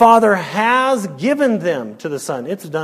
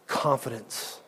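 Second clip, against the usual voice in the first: much softer, almost whispered comfort; hushed assurance; 6 semitones lower, 12 dB quieter, slower than usual. Acted. Pastor closing the sermon with hushed reassurance to the flock. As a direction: breathe the words of rest to them. Tender close, the Lord's assurance.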